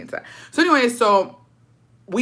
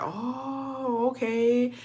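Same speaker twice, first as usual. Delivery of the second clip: soft, high, drawn-out reaction; imitated dad's quiet, loaded acknowledgment; knowing realization; 6 semitones higher, 6 dB quieter, much slower than usual. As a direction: draw it out softly, as if just spotting them. Dad spots the cousins.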